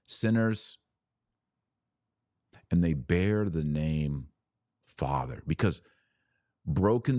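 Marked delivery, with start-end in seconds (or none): none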